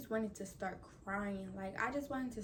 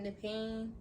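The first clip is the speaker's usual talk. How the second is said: ordinary talk, nothing marked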